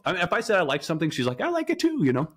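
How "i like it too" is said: high-pitched imitation of an eager admirer; mock eager agreement. Husband mimics wife claiming shared interests, mock eager. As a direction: chirp it high, mimicking her.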